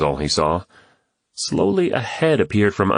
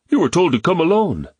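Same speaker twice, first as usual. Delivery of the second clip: higher, harder man's warning; angry; 11 semitones higher, 3 dB louder than usual. A narrator's reading. Jata's man confronting James, angry warning. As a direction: warn him off, angrily. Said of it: "said angrily".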